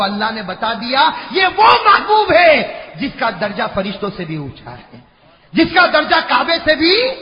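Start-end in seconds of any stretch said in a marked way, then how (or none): 1.3-7.1 high, loud sermon cry; fiery preaching. Acted. Preacher stirring the crowd, fiery preaching. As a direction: preach it out with fervour.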